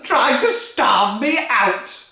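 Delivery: bully the whole family; furious Grandma. Acted. Grandma demanding her breakfast, loud bullying bellow.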